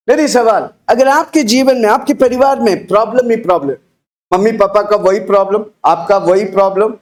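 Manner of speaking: ordinary delivery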